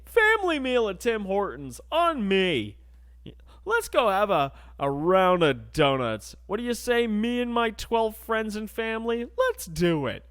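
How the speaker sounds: high, hammed-up merry customer voice; rally the whole crowd with hammed-up cheer; jolly family host. Dad treating friends and family to donuts, jolly host.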